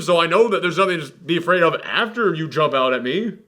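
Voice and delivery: ordinary talk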